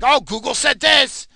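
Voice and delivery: high, loud sneering mimic of a naive person; mocking gullible voice. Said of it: "you can't just be like".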